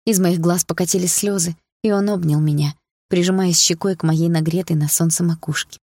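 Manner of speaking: plain reading voice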